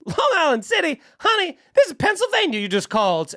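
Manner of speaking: high, sweetly puzzled phone lady; imitated kindly woman's high voice. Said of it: "to illustrate how sweet she was".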